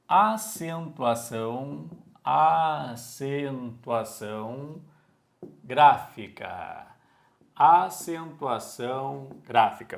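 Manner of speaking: very slow, drawn-out syllables; slow dictation. Real, not acted. Teacher writing the heading for the class, slow dictation. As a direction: stretch each word as if writing it out. Writing on board while saying it.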